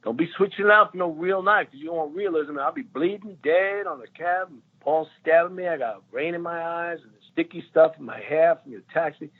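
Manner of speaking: high, animated Eddie Murphy riff; imitating Eddie. Eddie Murphy ranting to the crew about the knife, high. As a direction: rant it comically, pitch way up. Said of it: "he was like".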